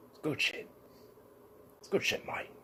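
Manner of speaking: hushed, unvoiced Aussie mumble; quiet whispered Australian "good shit, mate"; whispered Australian accent. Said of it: "I wasn't doing an Australian accent".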